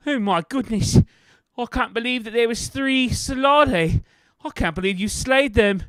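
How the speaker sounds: high, loud and flustered; an exasperated teacher, hands up in dismay; exasperated Rosie. Acted.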